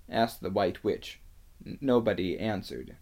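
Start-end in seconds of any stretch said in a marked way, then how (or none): none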